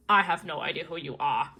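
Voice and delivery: low, put-on annoyed man's voice; irritated Invisible Man. Invisible Man brushing off the fangirl, low and irritated. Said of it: "he's really irritated with me".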